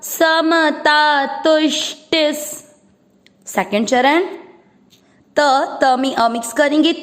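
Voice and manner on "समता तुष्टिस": slow chanted verse, a little raised; Sanskrit recitation. Shown as a demonstration. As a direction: recite the line slowly for students to follow. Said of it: "पहला चरण repeat करते हैं".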